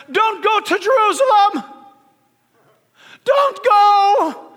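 high, loud, imploring warning; acted pleading cry. Believers urging Paul away from Jerusalem, in a high pleading cry. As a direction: cry out to stop a friend leaving.

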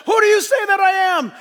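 high, booming pulpit shout; loud, insistent challenge; shouted question. Retold or imitated. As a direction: fire the question at full volume, quickly. Jesus' question shouted back at disciples.

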